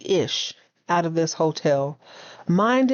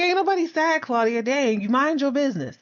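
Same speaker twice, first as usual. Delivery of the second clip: higher-pitched mock indignation; mock indignant retort; 6 semitones higher than usual. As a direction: imitate a man telling her off, pitched up. Acted retort in a retold quarrel.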